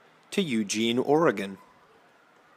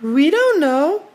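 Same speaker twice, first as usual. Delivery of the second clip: put-on very high, loud, drawn-out kid voice; children's voices; 7 dB louder, slower than usual. Children answering their father, high drawn-out kid voices. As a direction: answer like little kids, slow and squeaky-high. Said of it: "the children say".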